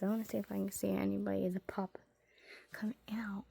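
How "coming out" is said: whisper. Real, not acted. Watching for spider in dark.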